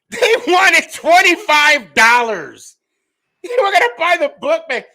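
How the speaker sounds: loud, very high incredulous squeal; high-pitched disbelief at being short-changed; comic outrage. Real, not acted. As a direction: squeal indignantly, half laughing. Outraged telling of tiny resale offer.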